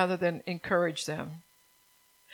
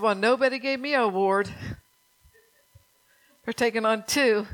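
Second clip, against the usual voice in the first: louder, pitched-up mock grievance; a raised, half-joking complaint; indignant retort; 3 semitones higher, 7 dB louder than usual. Mother retelling her jab at the first lady's award, indignant. Retold indignant quip at screen.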